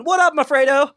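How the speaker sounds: high, loud wise-guy greeting; mock Italian greeting. Imagined Italian guys greeting each other, high and loud. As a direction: throw it out like a swaggering street guy.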